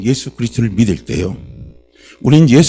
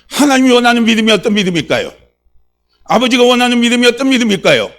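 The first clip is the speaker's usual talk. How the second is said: raised, loud preacher's voice; fiery preaching; 7 semitones higher, 7 dB louder than usual. Real, not acted. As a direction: fire up the congregation with insistent high-pitched questions. Preaching at full force.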